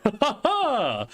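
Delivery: drawn-out stagey ha-ha-ha; put-on laugh. Joking about two identical Brians, slow put-on laugh.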